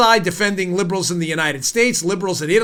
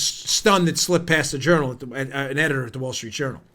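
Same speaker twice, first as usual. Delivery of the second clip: ordinary talk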